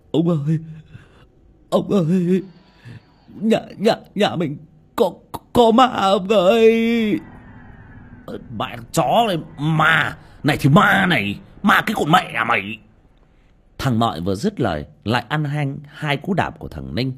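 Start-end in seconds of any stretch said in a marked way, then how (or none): 0.1-7.2 high, loud, stuttering panic; terrified stammer. Acted. Servant boy begging his master, terrified stammer. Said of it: "lắp bắp". 8.6-12.8 loud, higher furious cursing; angry cursing. Acted. Son cursing the servant while beating him, angry.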